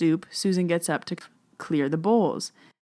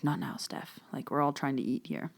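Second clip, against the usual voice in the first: softer, breathy hush, imitating husband; hushed reply; 3 semitones lower, 7 dB quieter than usual. Husband hushing her at the crowded table, quiet. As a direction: brush her off under the breath.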